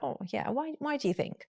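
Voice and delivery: soft, kind "why do you think"; "why" soft and caring; gentle tone. Shown as a demonstration. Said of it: "Tone is important".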